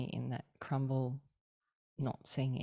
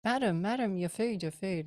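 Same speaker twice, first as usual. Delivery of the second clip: higher, louder call after a customer; calling shop assistant; 6 semitones higher, 5 dB louder than usual. Retold or imitated. Shop assistant calling after a leaving customer, urgent. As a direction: call her back urgently. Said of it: "Yeah, but you've paid for it".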